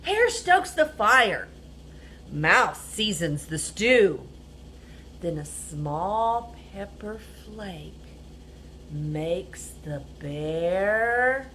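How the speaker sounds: lively picture-book reading; animated read-aloud storyteller; reading to children. A narrator's reading.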